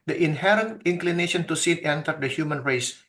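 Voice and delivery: ordinary delivery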